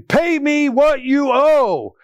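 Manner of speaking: grab him by the collar and demand it; furious creditor shouting for payment; angry demand. Acted.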